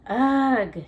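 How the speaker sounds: annoyed, loud ugh; sulky groan. Acted. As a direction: sulk it out. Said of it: "she sulked".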